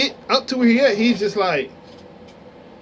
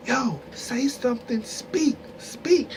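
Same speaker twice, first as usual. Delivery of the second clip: hushed, pushing him to talk; urgent hushed urging; 3 semitones higher, 7 dB quieter, slower than usual. Retold or imitated. Nudging his shy friend behind the dancer's back, hushed urging. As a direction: egg him on quietly behind her back.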